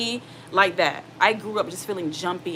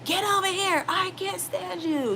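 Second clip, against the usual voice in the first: high-pitched mock parental yelling; private yelling mom; 12 semitones higher, slower than usual. Mom alone with her children in private, acted angry yelling. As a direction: rant at the child in a high, drawn-out yell. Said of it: "And then in private, you're like".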